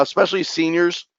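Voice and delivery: ordinary delivery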